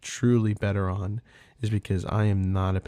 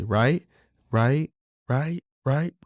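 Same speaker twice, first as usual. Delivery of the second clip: each "right" climbs higher and louder; building nagging; 5 semitones higher, 5 dB louder, much slower than usual. Retold or imitated. Daughter pestering busy dad, rising louder and higher. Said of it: "it'll get louder and more aggressive".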